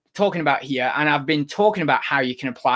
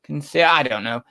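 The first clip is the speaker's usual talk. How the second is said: normal talking voice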